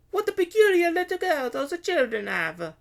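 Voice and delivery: high, loud stallholder's voice; haughty vendor woman. A narrator's reading. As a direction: remark loudly as a snooty market woman. Carlotta voiced, eyeing the princess.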